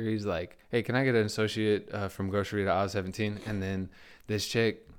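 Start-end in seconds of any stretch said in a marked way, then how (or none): none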